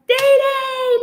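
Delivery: high calling out of the name; mock yell across the room; retold yell of a name. Friend calling out to her after the play, high and loud. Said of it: "like, screaming and yelling".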